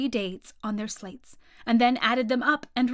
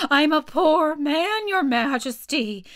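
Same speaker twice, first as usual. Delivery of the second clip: high, slow, loud plea; plaintive, high-pitched begging; pleading Hatter; 5 semitones higher, 5 dB louder, slower than usual. A narrator's reading. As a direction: beg the king, drawing it out. Hatter begs on one knee.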